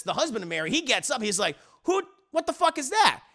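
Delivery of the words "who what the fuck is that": high, mock-outraged demand; acted alarm. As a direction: play the husband startled and suspicious. Husband hears the phone.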